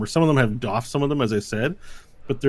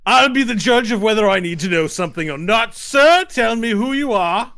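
angry knight; 11 semitones higher, 7 dB louder than usual. Acted. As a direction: snap at the evasive stranger. Knight loses patience.

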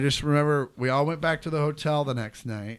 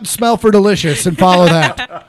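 mock command; 10 semitones higher, 12 dB louder than usual. Joking instruction, loud.

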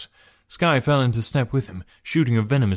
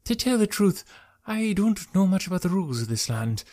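controlled voice; 7 semitones higher than usual. A narrator's reading. Traveller to archer admitting ignorance, voice under control. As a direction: admit it carefully, keeping control. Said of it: "His voice was better under control".

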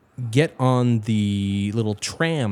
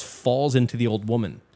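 normal talking voice

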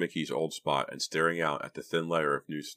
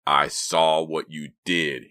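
slow, loud, gruff hoarse rasp; raspy; 7 dB louder, much slower than usual. A narrator's reading. Anonymous caller threatens girl by phone, hoarse rasp. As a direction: rasp it out deliberately. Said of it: "he rasped".

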